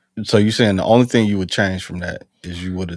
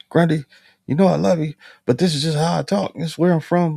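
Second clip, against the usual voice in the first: higher, louder mocking voice of Johnson; imitating Johnson; 9 semitones higher, 5 dB louder than usual. Marine retelling buddy's excuse, mock higher voice. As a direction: play the careless buddy brushing it off.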